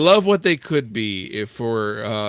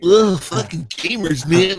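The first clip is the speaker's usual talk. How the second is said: slow, loud, higher-pitched groaning scorn; mock disgust; 6 semitones higher, 6 dB louder than usual. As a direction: groan it out as exaggerated exasperation.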